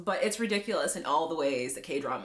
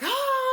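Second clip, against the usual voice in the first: high, loud mock gasp of panic; panicked cry; 5 dB louder than usual. Gunso sees the accident he caused, mock panicked cry. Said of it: "He's, like, totally panicking".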